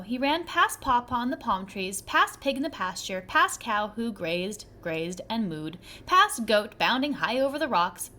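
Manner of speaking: usual speaking voice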